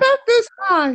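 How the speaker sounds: squeaky falsetto far above normal; tiny high-pitched voice. Shown as a demonstration. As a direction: push the voice as high as it goes. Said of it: "how high can your voice go".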